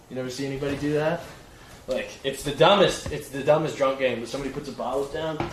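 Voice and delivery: ordinary talk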